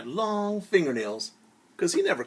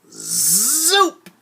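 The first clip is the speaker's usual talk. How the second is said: high loud rising zoop; magic zoop sound; 11 semitones higher, 8 dB louder than usual. Acted. Father's magic making the giant disappear, a loud zoop. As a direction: zap it away with a sound.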